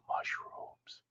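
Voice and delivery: hushed, unvoiced whisper of "Mushrooms"; barely-there whispered aside. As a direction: whisper it very softly, like an echo. Repeats the word in a whisper.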